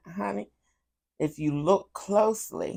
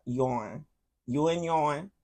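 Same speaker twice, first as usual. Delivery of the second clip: southern yourn; 5 dB quieter, slower than usual. Retold or imitated. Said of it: "that's how people down south say yours".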